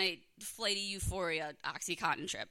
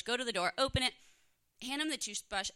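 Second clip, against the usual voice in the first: normal talking voice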